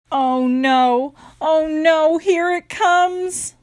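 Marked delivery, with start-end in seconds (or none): none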